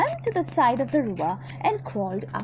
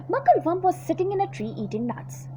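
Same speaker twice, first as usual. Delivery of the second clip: plain reading voice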